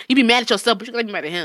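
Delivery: ordinary talk, nothing marked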